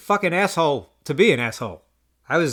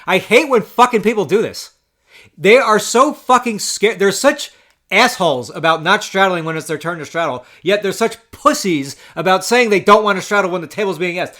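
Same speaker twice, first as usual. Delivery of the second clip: louder, higher, heated venting; exasperated rant; 4 semitones higher, 6 dB louder than usual. Real, not acted. Poker player venting about people who won't straddle, exasperated. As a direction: let the annoyance burst out, punching the swear words.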